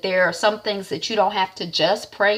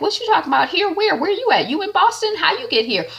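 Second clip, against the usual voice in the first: much higher, rapid-fire surprised questions; surprised Reggie; 11 semitones higher, faster than usual. Retold or imitated.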